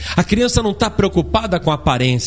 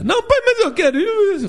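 put-on much higher, whiny kid protest; whining child. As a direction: whine like a child refused at the door.

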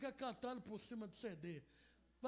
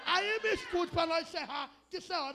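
loud, higher call to listen; 5 semitones higher, 11 dB louder than usual. Real, not acted. Preacher calling the congregation to listen before closing, loud. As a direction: grab the room's attention.

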